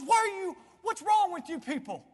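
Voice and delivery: high, loud challenge; exasperated rebuke. Acted. The Lord to weeping mourners, exasperated rebuke. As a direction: call the mourners out sharply.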